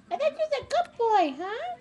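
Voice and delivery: mock scolding. Real, not acted. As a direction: tease the dog in a high, quick voice. Teasing scold to the dog.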